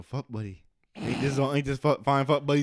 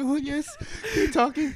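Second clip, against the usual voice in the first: much higher, excited mock plea; mock eager fan; 13 semitones higher than usual. Imitating listeners begging them to continue, high and eager. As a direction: squeak it up high, thrilled. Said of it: "be like".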